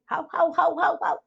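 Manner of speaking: high, loud mock barks of how; a playful mock dog bark; imitated dog barking. Someone claiming a dog's barking counts as poetry, with high mock barks. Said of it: "some people will say".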